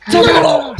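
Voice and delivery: high, loud creature yell; kobold shout. Acted. Game master as kobold Tim after player's egging, shouting. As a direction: shout the name high and hard.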